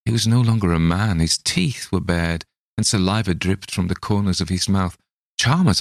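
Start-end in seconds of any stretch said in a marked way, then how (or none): 5.4-5.8 higher urgent call; cried out. Acted. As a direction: call his name to snap him out. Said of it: "I cried".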